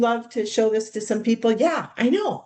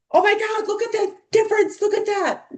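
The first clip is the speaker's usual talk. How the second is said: much higher, thrilled gasp at the difference; excited squeal; 13 semitones higher than usual. Retold or imitated. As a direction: imitate guests amazed at their own skin. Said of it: "That's how you create excitement".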